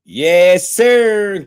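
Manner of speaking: loud, high yes sir yelled out in joy; excited shout. Real, not acted. Streamer cheering his fighter's news, loud and high. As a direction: let it burst out, cheering.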